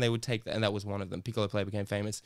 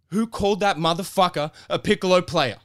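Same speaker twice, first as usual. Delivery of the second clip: loud, higher punchline bellow; punchline shout; 7 semitones higher, 7 dB louder than usual. Acted. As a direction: hit the last line hard and loud. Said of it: "I loved the delivery".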